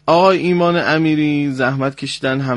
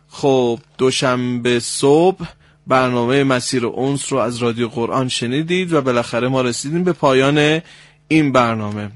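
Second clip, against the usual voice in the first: normal talking voice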